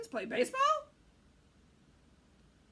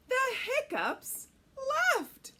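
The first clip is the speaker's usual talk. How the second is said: excited; 13 semitones higher, 9 dB louder, slower than usual. A narrator's reading. Story climax.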